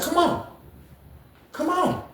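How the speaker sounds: very high, soft squeaky come on; squeaky taunt. Retold or imitated. BB taunting the dude to fight, very high squeaky voice. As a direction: tease him in a tiny high voice.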